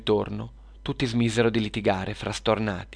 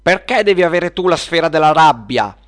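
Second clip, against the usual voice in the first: high, loud indignant demand; quarrelling; 7 semitones higher, 10 dB louder than usual. Acted. Witch demands the sphere from her rival, quarrelling. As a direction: protest it loudly like a squabbling child. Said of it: "iniziarono a litigare tra loro".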